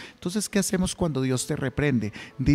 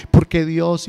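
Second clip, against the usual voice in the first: a loud, complaining cry of why God; a hammed-up grumble at God; mock lament; 3 semitones higher, 6 dB louder, slower than usual. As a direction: whine it at heaven, louder. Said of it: "renegando y llorando".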